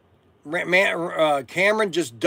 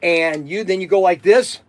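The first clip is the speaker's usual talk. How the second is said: normal talking voice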